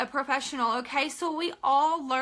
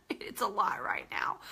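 unvoiced aside under the breath; whispered confession of overwhelm; 7 semitones lower, 6 dB quieter than usual. Real, not acted. Aside after laughing.

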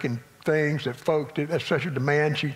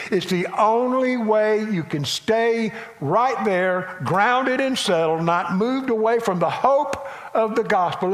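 fiery preaching; 5 semitones higher than usual. Acted. Pastor urges the congregation to submit, preaching at full force. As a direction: drive the point home to the church.

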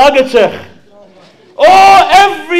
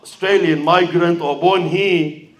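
ordinary talk, nothing marked

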